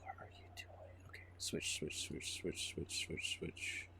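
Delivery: urgent breathy whisper. Real, not acted. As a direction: hiss it under your breath, urgently. Frantic hushed in-game moment.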